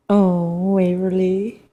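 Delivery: normal talking voice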